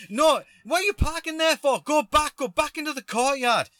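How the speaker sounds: high, loud irate ordering; imitated mad stranger. Stranger ordering van back into courtyard, imitated angry yell. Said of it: "getting madder and madder".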